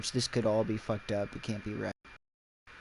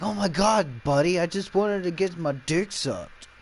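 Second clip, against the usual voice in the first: high-pitched retold freak-out; 6 semitones higher than usual. Stall guy to Ass Face after seeing his mouth, mock freak-out. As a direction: act out a panicked stranger, voice pitched up. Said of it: "the guy ends up freaking out".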